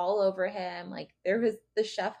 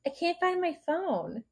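higher, slow mock-helpless whine; mock helpless ploy; 8 semitones higher, 4 dB quieter than usual. Podcaster imitates crewmate claiming a lost phone, mock helpless. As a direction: play helpless to lure him over.

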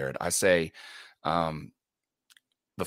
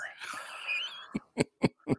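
choked-up breathy laugh, close to tears; tearful laugh; 6 dB quieter than usual. Real, not acted. Moved father laughing through tears at his daughter's words. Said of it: "I'm almost crying now".

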